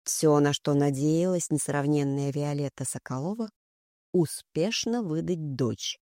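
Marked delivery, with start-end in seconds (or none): none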